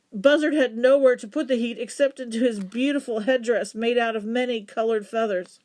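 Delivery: usual speaking voice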